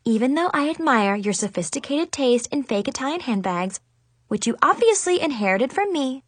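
ordinary delivery, nothing marked

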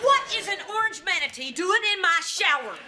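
high-pitched, appalled holler; outraged demand. Acted. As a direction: cry out in disbelief at the intruder. Outraged at finding man in shower.

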